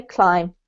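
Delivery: "Climb" with a clear "cl" and a long "ai"; teaching pronunciation. Shown as a demonstration. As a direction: model "Climb" carefully, not like "clean". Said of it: "the word is climb, not clean".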